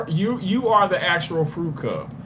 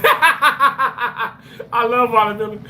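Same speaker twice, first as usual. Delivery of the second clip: higher, slow, laughing through the words; laughing confession; 6 semitones higher, much slower than usual. Real, not acted. Streamer to chat, laughing. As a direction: laugh it out as you own up to loving it. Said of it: "I'm lying".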